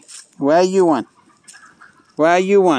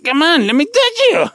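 1 high coaxing; 10 semitones higher than usual. Real, not acted. Coaxing animal.

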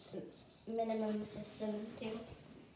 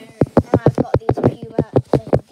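loud; 20 dB louder, slower than usual. Real, not acted. Loud and slow emphasis.

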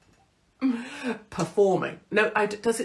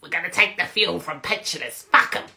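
mock Rachel Reeves; 3 dB louder than usual. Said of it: "And then she goes".

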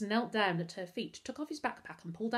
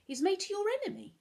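high, quick, concerned question; frowning question; 13 semitones higher, 4 dB louder, faster than usual. A narrator's reading. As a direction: ask with a troubled frown.

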